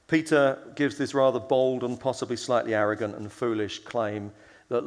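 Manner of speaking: ordinary delivery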